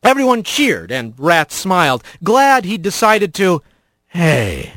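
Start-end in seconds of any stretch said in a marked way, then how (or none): 4.1-4.7 slurred voice. A narrator's reading. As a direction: drawl it out woozy and slurred. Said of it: "A slurred voice behind him".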